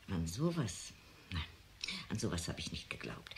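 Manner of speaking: awed whisper, voice dropped right down; hushed whisper. Acted. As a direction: breathe it out in disbelief. Ergriffenes Flüstern über den Unfall. (ehrfürchtiges Flüstern)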